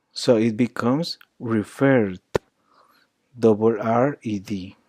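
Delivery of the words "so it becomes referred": usual speaking voice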